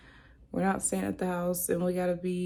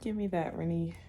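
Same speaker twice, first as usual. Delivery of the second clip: softer whispered aside; 5 semitones lower, 5 dB quieter than usual. Real, not acted. Whispering to Rennie mid-vlog, quiet. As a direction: hush it to the little one off to the side.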